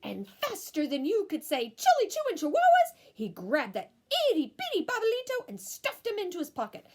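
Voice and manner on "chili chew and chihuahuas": quick exclamation. Acted. Quick quoted phrase.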